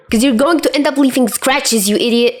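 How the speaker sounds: high exasperated shriek. A narrator's reading. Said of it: "Chuya shrieks".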